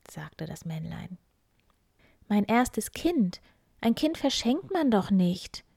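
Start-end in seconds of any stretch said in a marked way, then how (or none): 2.3-5.6 miller's daughter, horrified; shocked cry. Acted. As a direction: recoil in shock. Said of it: "rief die".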